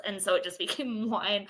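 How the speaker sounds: ordinary talk, nothing marked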